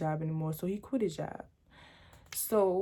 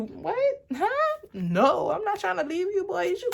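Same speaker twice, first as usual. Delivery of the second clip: much higher, panicked and pleading; frantic denial; 11 semitones higher, 3 dB louder than usual. Retold or imitated. Girlfriend frantically denying to the boyfriend with a knife. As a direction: squeak out that you're not leaving. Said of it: "I'm like".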